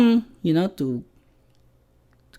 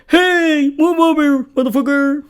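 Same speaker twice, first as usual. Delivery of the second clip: tough New Yorker; 7 semitones higher than usual. Retold or imitated. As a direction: playfully imitate a pushy New Yorker. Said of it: "I feel like I should be there like".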